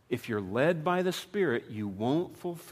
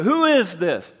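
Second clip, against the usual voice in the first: loud, higher mock question; mock non-recognition; 4 semitones higher, 9 dB louder than usual. Answering a kid calling from college, loud mock non-recognition. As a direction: boom it as if you had no idea. Mock not knowing kid's voice.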